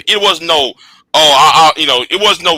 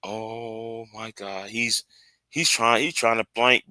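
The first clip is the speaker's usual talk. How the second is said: stunned hush; 7 semitones lower, 13 dB quieter than usual. Retold or imitated. Streamer quoting his disbelief at the commentator, hushed. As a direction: breathe it out in disbelief. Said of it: "I said".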